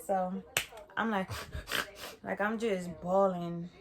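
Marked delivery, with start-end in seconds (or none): 1.4-2.2 a quiet little laugh, rising at the end; soft laugh. Real, not acted. Storyteller laughs recalling how hard she cried, soft laugh. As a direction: chuckle softly.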